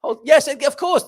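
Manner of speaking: ordinary talk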